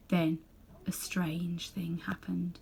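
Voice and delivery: slow hushed whisper; suspenseful whisper. Acted. Narrator whispers the turning point to listeners. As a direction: breathe it out to build suspense.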